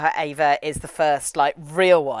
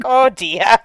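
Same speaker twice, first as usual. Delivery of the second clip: mock dismay; 5 semitones higher, 6 dB louder than usual. Voicing poor embarrassed Shinji.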